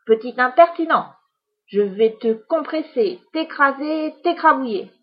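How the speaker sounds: loud, rising witch threats; menacing witch building up; threatening witch. Acted. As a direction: crush him with every word.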